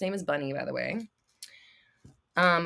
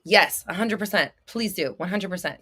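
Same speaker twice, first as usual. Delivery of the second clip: usual speaking voice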